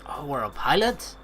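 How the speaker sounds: usual speaking voice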